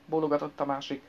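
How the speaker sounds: plain reading voice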